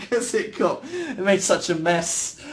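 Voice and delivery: high, giggly voice; laughing while talking. Real, not acted. Streamer confessing a bagel mishap to viewers, laughing. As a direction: talk through real laughter.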